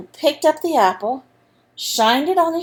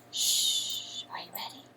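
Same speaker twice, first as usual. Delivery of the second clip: hushed whisper to settle listeners; breathy hush, barely audible; 17 dB quieter than usual. Acted. Storyteller whispering to kids to get ready. Hushing children before the story.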